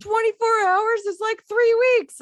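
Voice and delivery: high, loud indignant protest; hammed-up shrill complaint from the crowd; mock outraged townsperson. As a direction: squawk it like an outraged local. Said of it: "that person in the background goes".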